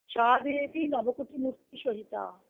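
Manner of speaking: usual speaking voice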